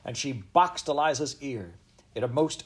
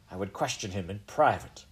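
usual speaking voice

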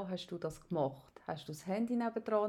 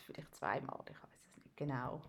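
embarrassed whisper; 8 semitones lower, 10 dB quieter than usual. Real, not acted. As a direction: confess it under your breath. Embarrassed admission. (verlegenes Flüstern)

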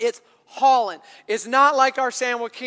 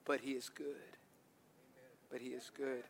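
soft, low and hushed; a hint of the solemn beaver, quiet; 10 semitones lower, 12 dB quieter than usual. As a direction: lean in and say it gently. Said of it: "And the beaver says".

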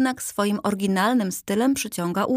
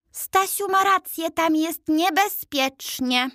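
put-on much higher, slowed old man's voice; second old gentleman; 9 semitones higher than usual. A narrator's reading. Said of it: "dodał drugi głos".

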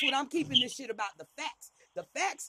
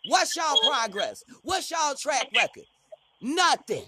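pointed challenge; 7 dB louder than usual. Real, not acted. Demanding answers from members.

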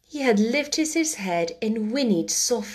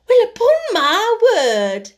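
much higher, louder, slow astonished Yorkshire woman; 12 semitones higher, 8 dB louder than usual. A narrator's reading. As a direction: gasp it out in broad Yorkshire. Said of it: "speaking rather broad Yorkshire herself".

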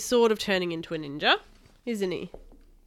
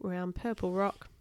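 plain reading voice

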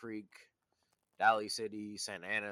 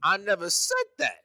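indignant protest; 6 semitones higher than usual. Real, not acted. Heated denial of being accused.

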